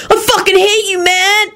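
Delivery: high, sustained angry yell; screamed. A narrator's reading. Boy at his brother across the table, screaming. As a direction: scream it on one high pitch. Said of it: "Kenny screamed from the end".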